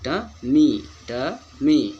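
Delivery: two slow beats, ट then strong मी; uneven stress demo. Shown as a demonstration. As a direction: punch the मी in टमी. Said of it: "इस पर ज़्यादा हम stress दे रहे हैं".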